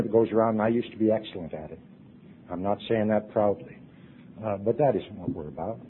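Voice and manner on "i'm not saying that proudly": usual speaking voice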